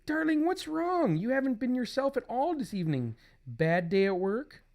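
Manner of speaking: high-pitched comic wife voice; concerned wife. Acted. Wife asks husband what's wrong, high concerned voice. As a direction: act a fretting wife, pitched well up.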